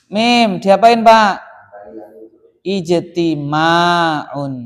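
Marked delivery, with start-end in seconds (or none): 2.6-4.5 "Ijtima'un" drawn out syllable by syllable; stretched "Ijtima'un" with a long middle vowel; slow model answer. Shown as a demonstration. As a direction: sound out "Ijtima'un" very slowly. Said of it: "Berarti menjadi ijti".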